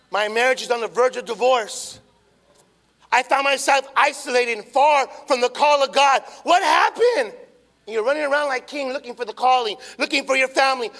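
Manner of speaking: loud, rising preacher's plea; impassioned preaching. Acted. Pastor pleading with the church, loud and urgent. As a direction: pour it out over the congregation. Preaching climax about losing family.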